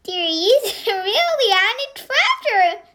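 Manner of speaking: mocking laugh mid-sentence; laughing scoffingly. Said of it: "The Sue laughed".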